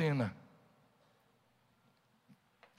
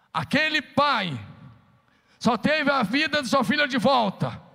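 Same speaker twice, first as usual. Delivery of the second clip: higher, louder, slow, hammering each "aonde"; preaching at full force; 7 semitones higher, 4 dB louder than usual. Acted. Preacher driving the congregation to faith, rising to a shout. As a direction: build it to a shouted climax. Preaching climax over music.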